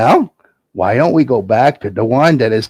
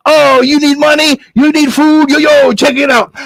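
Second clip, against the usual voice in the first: high slangy dealer voice; hip stranger dude; 11 semitones higher than usual. Acted. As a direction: hustle the kid. Stranger bargains.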